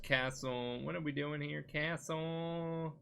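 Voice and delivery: very soft muttering to oneself; absent-minded murmur. Real, not acted. Podcaster looking up quest names in a guide, absent-minded murmur. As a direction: murmur idly while searching, drifting into little doo-doos.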